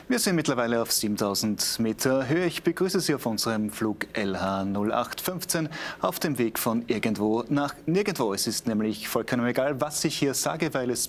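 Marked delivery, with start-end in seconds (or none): none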